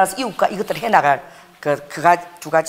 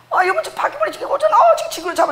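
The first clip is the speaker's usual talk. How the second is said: very high, urgent begging; panicked plea. Retold or imitated. Wife begging her husband to kill the cockroach, very high. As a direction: squeal at your husband to hurry.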